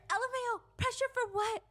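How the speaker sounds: high-pitched scoffing retort; acted scornful squeal; mocking disbelief. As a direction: throw it back way up high, incredulous. Acts out a scornful text reply.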